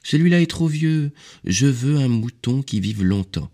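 put-on high, insistent child-like refusal; little prince. A narrator's reading. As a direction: protest in a high little boy's voice. Little prince rejects another drawing.

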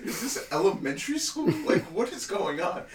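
high, laughing incredulity; laughing disbelief. Real, not acted. Ranting to his friend in disbelief, laughing and high. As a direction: laugh through the outrage.

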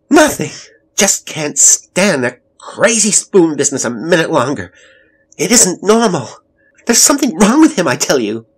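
high, loud, frantic outburst; furious quarrel. A narrator's reading. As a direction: shout back at your husband, exasperated. Wife shouts back in quarrel.

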